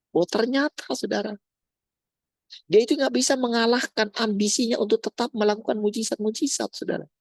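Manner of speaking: high, sustained exclaiming; impassioned preaching. Acted. Preacher revealing the teacher's failing to the congregation, high and impassioned. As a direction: press the revelation on the congregation.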